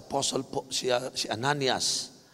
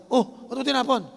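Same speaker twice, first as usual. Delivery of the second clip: friend's challenge; 10 semitones higher, 4 dB louder than usual. Retold or imitated. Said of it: "Sabi sa akin ng barkada".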